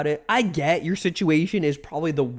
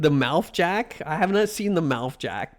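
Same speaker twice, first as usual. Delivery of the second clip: ordinary delivery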